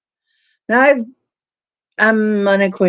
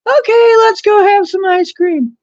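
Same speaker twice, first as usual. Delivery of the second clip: high, louder, eager; high eager invite; 14 semitones higher, 5 dB louder than usual. Retold or imitated. Husband leading wife into ice cream shop, high eager invite. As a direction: sell the ice cream idea.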